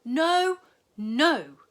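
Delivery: high, loud alarmed cry; family crying out in alarm; cried out. A narrator's reading. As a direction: shout a frightened no. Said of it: "they cried".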